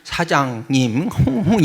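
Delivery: cooing nasal flirt demo; put-on nasal sweet-talk; stuffed-nose coquetry. Shown as a demonstration. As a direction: draw it out through the nose with a little hum. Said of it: "코 먹은 소리는".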